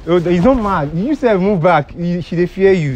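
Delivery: high, loud protest; urgent intervention. Real, not acted. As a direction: call out to keep someone back. Intervening between woman and crew.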